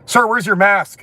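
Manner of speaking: loud, high, pushy demand; aggressive demand. Retold or imitated. Store clerk confronting him over a mask, loud and aggressive. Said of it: "they say it in a really aggressive way".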